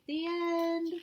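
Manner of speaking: high, drawn-out "The end"; storybook ending. Shown as a demonstration. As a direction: announce the ending in a high, held-out flourish.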